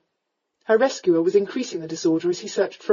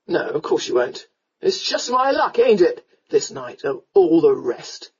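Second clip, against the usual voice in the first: low tipsy lament with a louder middle; drunken rogue, resigned grumble; 3 semitones lower, 3 dB louder than usual. A narrator's reading. Drunken rescuer's voice.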